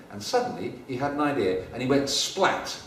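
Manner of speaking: plain reading voice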